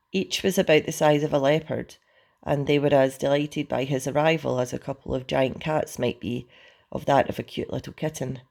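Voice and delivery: usual speaking voice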